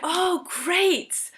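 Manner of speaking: high, bright-toned relieved exclamation. Retold or imitated. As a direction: sound thrilled you're not alone. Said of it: "I was so relieved".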